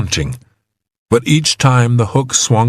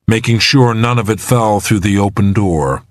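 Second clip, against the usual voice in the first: usual speaking voice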